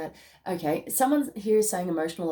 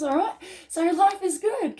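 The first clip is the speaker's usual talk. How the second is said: normal talking voice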